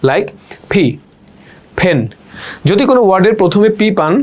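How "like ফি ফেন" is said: ফি and ফেন with a burst of air; ফি and ফেন with a puffed p; aspirated p. Shown as a demonstration. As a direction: blow the p out on ফেন. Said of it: "বাতাস sound ধাক্কা দিয়ে বের হবে".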